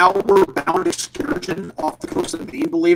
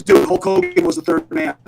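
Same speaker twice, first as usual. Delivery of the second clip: friend's blurt; 9 semitones higher than usual. Retold or imitated. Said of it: "Nigel walks up to me and goes".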